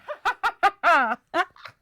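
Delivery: high cackling witch laugh. Acted. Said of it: "You've got a good witch laugh".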